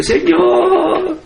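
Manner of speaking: high, stretched cry of Señor; retold cry to God. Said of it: "le grité". (grito agudo y alargado de Señor, imitando)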